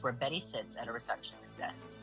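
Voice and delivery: normal talking voice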